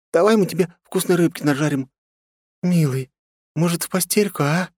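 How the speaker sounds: high, whining complaints; whiny girl voice. Acted. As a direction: whine like a bored girlfriend. Girls whining for hours.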